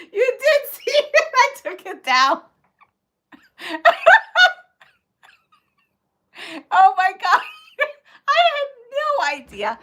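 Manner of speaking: high, loud, real laughter through the words; laughing so hard she can barely speak. Real, not acted. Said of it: "tears coming down me because".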